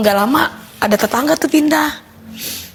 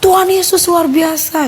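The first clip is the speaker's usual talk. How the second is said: high, drawn-out exclamation, only mildly raised; retold cry; 6 semitones higher, slower than usual. New neighbour crying out in praise at the door, retold cry. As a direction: imitate someone crying out, pitched up and slow. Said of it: "Terus dia teriak".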